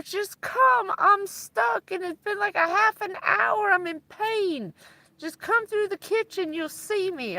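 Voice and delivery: mock tearful plea, high and strained; retold crying friend. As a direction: imitate a stuck friend begging for help. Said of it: "she's crying on the phone".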